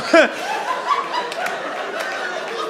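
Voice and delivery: very high squeaky breathy squeal; squealed falsetto noise imitation; mock noise. Shown as a demonstration. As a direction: imitate the silly song sound. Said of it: "Then it go like this".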